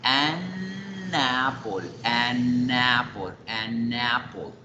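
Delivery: "an apple" slowly drawn out, the n flowing into apple; an apple fluido. Shown as a demonstration. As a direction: stretch and link "an apple" without a break. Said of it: "Todo sigue fluido, el aire no se estanca". ("an apple" lento y ligado, alargando la unión)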